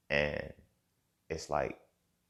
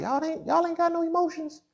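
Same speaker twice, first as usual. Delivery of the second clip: much higher, louder mocking put-on voice; mocking accuser; 8 dB louder than usual. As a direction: sneer it out as the scolding crowd, high and loud. Mocking voice of others' judgement.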